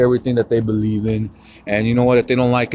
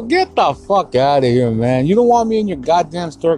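retold indignant rebuff, pitched up; angry dismissal; 6 semitones higher than usual. Shopper rejecting the guard's apology, angry. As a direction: send them off with rising, exasperated emphasis.

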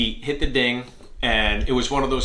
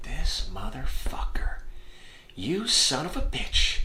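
whispered fury; 3 semitones higher, 5 dB quieter, slower than usual. Retold or imitated. Inner rage at line cutter.

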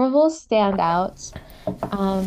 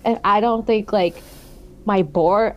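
ordinary talk, nothing marked

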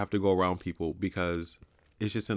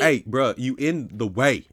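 louder, slow blunt complaint; brusque call-out; 4 semitones higher, 6 dB louder, slower than usual. Retold or imitated. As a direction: tell them plainly they're blocking. Rude shopper telling off.

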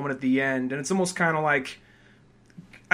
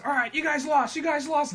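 mock ref; 12 semitones higher than usual. Ref declaring forfeit.

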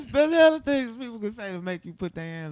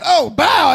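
shouted punch noise; mock punch sound; 3 semitones higher, 6 dB louder than usual. Retelling the punch to friends, shouted sound effect. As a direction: yell the impact like a cartoon hit.

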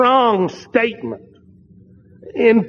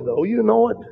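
normal talking voice

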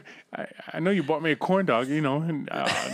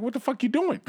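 mock doctor surprise; 8 semitones higher than usual. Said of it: "The doctor's like".

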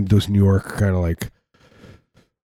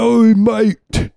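high, loud cartoon Cockney call; exaggerated high-pitched English accent; mock English accent; 13 semitones higher, 5 dB louder than usual. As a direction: yelp it like a mock Cockney rocker. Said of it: "I don't know why they have English accents".

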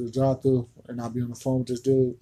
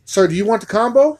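high, loud mock cashier question; upselling cashier; 9 semitones higher, 7 dB louder than usual. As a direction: pitch the combo up like a clueless clerk. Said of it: "Don't come back at me and say".

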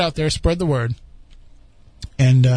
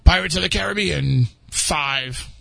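slow, spaced-out trailer read; movie commercial announcer. Retold or imitated. Co-host quoting the movie commercials, slow announcer imitation. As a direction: imitate a film commercial, drawing out the title. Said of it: "Like the commercials for the movie".

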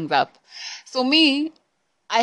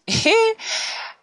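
high laugh. Real, not acted. Reacting to absurd text.

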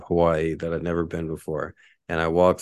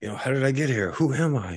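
mock-lost questions, pitched up; mildly hammed-up disorientation; retold bewilderment; 6 semitones higher than usual. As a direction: act out waking up confused. Retells waking lost and confused.

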